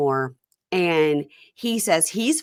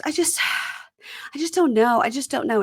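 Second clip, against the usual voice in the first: sighing indecision; 6 semitones higher, faster than usual. Retold or imitated. She sighs, torn between guys.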